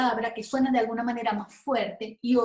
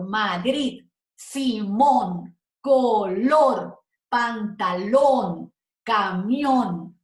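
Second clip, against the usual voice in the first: "Madrid, Simón, color, pantalón, camión" slowly, each final syllable punched louder; stressed last syllables; 4 dB louder, much slower than usual. Shown as a demonstration. Teacher listing agudas, stress on last syllable of "Madrid, Simón, color, pantalón, camión". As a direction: stretch each word and swell on DRID, MÓN, LOR, LÓN, MIÓN. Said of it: "la que suena más fuerte es la última".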